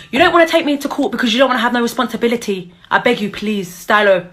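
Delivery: usual speaking voice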